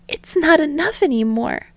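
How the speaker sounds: put-on a boy confessing love while holding back; emotional confession. Daiki breaks away.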